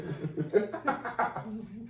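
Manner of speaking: real soft, high fit of giggles; laughter. Real, not acted. Cracking up at co-host's joke, quiet laughing. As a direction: laugh till the breath runs out.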